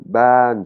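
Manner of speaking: BAN pitched high, heading upward; "ban" as a long high Thai tone. Shown as a demonstration. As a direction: lift "ban" up high and hold it. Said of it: "c'est un son aigu, il part vers le haut".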